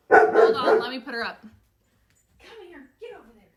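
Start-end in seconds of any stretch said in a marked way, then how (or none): none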